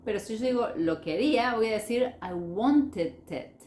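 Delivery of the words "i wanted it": "I wanted it" slowly, as wan-te-dit; linked words. Shown as a demonstration. Teacher showing how the past sounds, slowly linked. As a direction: join the d of "wanted" onto "it". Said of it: "Fíjense cómo suena eso".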